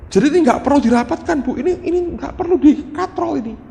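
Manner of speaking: very high-pitched, insistent amazement; excited announcement. Retold or imitated. Teacher telling mother her child passes, excited announcement.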